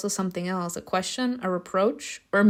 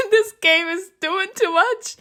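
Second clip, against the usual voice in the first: much higher pitched, incredulous reaction; amused disbelief; 11 semitones higher, 4 dB louder than usual. Real, not acted. Player reacts to an over-the-top game, high incredulous protest. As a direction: exclaim it high, as if overwhelmed.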